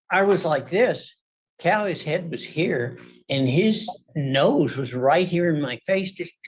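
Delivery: ordinary delivery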